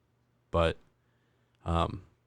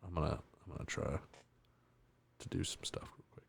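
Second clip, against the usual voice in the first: whisper; 4 semitones lower, 17 dB quieter, faster than usual. Real, not acted. Aside while fiddling with phone.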